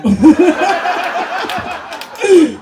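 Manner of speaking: loud high burst of laughter; cracking up hard at the line; big laugh. Real, not acted. Comedian cracking up at own joke, loud laugh.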